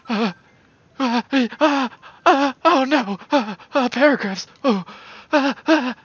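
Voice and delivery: very high, loud, frantic stammering; squeaky panic. Retold or imitated. Playing the villager drowning in paragraphs, shrill panic. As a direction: cry for help like a tiny panicking villager.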